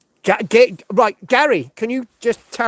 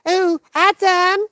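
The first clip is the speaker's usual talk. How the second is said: higher, breathy-free mock seductive Eve, slow on the sighs; Eve impression; 8 semitones higher, slower than usual. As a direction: swoon over Adam in a hammed-up female voice. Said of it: "I'm not gonna do fucking Eve".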